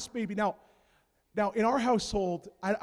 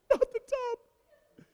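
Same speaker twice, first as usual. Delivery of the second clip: drawn-out lament; much slower than usual. Retold or imitated. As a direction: stretch it out mournfully. Repeat, drawn out softly.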